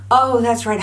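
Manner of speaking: normal talking voice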